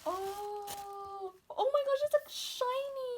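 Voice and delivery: high squeal of wonder; thrilled awe. Real, not acted. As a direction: rise into a delighted squeal. Admiring the unboxed item.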